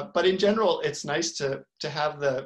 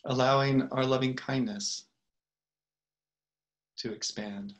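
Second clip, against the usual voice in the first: unhurried meditation guide with long pauses; calm, spacious guidance, letting each phrase settle; guided meditation; 3 dB louder than usual. Real, not acted. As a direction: lead them gently into the breath, phrase by phrase.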